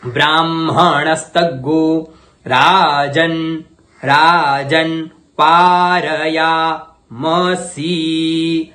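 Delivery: Rajan पारयामसि drawn out very slowly; slow in pieces. Shown as a demonstration. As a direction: recite it slowly word by word. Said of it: "मैं टुकड़ों में कर रहा हूं". (बहुत धीरे धीरे पाठ)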